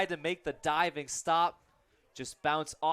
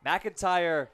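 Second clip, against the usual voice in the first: normal talking voice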